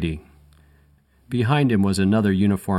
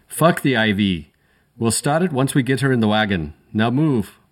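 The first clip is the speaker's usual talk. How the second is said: curt and pitched up, a light brogue; impatient Irish paramedic; 4 semitones higher than usual. A narrator's reading. Paramedic snapping at his partner to move, impatient Irish. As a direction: brush her off and get moving.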